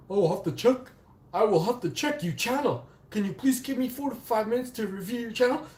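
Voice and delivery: high, mocking customer-service voice; mocking support agent.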